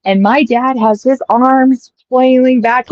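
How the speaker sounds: ordinary delivery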